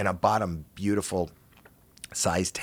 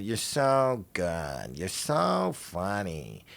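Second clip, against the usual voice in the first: slow, drawn-out imitation of gushing praise; Mitzi; much slower than usual. Comic imitates club owner praising him, slow drawl. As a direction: stretch each word, lightly put-on voice.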